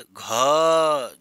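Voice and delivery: घ loud with a heavy breath burst; aspirated demo. Shown as a demonstration. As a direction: blow extra air out on घ. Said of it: "ज़्यादा हवा निकालनी पड़ रही है".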